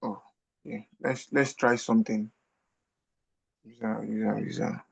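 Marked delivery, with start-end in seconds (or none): none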